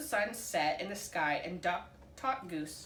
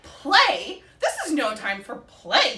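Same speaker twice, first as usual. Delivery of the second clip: very loud, indignant; yelling; 6 semitones higher, 13 dB louder, slower than usual. A narrator's reading. Duck yelling at the blue bird, very loud. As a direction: yell it out. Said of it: "yelled Duck".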